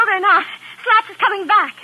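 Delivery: frightened cry as the gangster returns; alarmed cry. Acted. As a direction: sound the alarm in panic. Sees gangster returning, panics.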